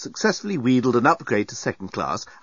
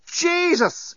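high, shocked cry of disbelief; shocked exclamation. Acted. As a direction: blurt it out in shock, pitched up. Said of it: "exclaimed Lucy".

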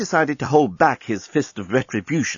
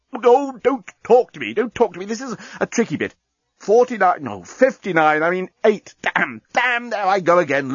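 high, flustered machine voice; a bomb losing count in a fluster; talking bomb; 7 semitones higher than usual. Acted.

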